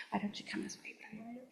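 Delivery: hushed whisper beckoning someone over. Real, not acted. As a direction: quietly wave him up with just breath. Calling the student up during a ceremony.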